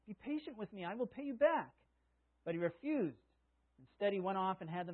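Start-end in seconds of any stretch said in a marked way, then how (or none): 0.0-1.7 a hint of desperate begging; pleading. A touch of a servant on his knees begging. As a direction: beg for more time. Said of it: "fell to his knees and begged him".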